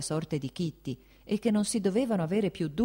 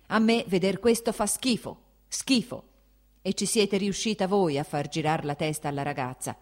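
loud, higher furious tirade; angry shouting; 3 semitones higher, 4 dB louder than usual. Acted. The prince blaming his wife for the evening, angry shout. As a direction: hammer every accusation home.

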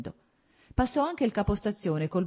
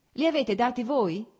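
higher, louder call across the crowd; shouted from behind; 7 semitones higher, 6 dB louder than usual. Acted. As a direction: call out from the back. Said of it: "gridò da dietro Oblonsky".

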